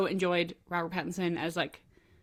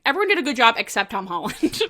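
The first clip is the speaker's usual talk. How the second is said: quoting the crowd, louder; mock the popular opinion, a bit louder; mocking the crowd's consensus; mocking everyone; 4 semitones higher, 9 dB louder than usual. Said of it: "everyone's like".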